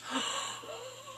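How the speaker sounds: high gasp of surprise; shocked gasp. Real, not acted. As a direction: gasp in disbelief. Shock at Littlefinger's name.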